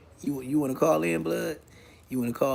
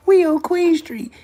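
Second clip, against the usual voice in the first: mock boast; 13 semitones higher, 6 dB louder, slower than usual. Mimicking a chatter's boast.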